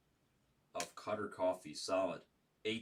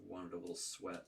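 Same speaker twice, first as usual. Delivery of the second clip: normal talking voice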